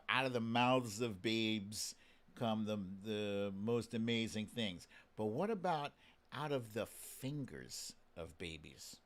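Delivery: normal talking voice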